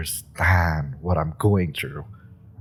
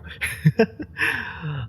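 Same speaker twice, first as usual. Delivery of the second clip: sheepish high laugh; 11 semitones higher than usual. Acted. Laugh after admitting bribery.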